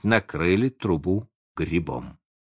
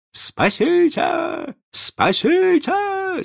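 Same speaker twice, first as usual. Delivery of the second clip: high, drawn-out scream for rescue; cry for help; much slower than usual. A narrator's reading. Boy fleeing a pack of wolves, screaming for help. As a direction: scream it long, climbing higher the second time. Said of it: "закричал Задавака".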